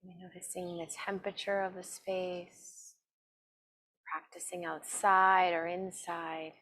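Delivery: slow, calm meditation voice with long pauses; guided meditation. Acted. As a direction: guide them gently inward, evenly and unhurried. Leads a seated meditation.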